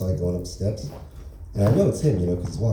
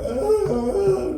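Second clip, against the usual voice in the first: very high imitated wail; exaggerated impression of a mother bawling; mock bawling. Mom left at the roadside as car pulls out, mock bawling. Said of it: "standing by the side of the road just bawling".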